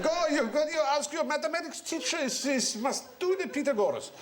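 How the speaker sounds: imitating a Hungarian grandfather, half laughing. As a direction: urge the boy on in a Hungarian accent. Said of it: "'cause he was Hungarian".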